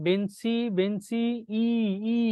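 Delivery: "বেঞ্চি" with rising, held i, then "ই" alone; teacher singling out the "ই" that ends "বেঞ্চি"; final vowel isolated. Shown as a demonstration. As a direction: draw out the last sound of "বেঞ্চি" as "ই". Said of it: "উচ্চারণটা কোথায় হচ্ছে".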